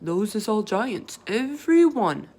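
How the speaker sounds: lightly voiced big friendly giant, folksy; a kindly giant telling Sophie what she sees; BFG voice. Giant telling Sophie about the others, lightly voiced.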